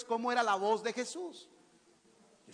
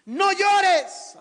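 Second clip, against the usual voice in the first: high, forceful shout; loud preacher's exclamation; loud command; 11 semitones higher, 12 dB louder than usual. Acted. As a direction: shout it as a blunt order. Acting out Jesus's words loudly.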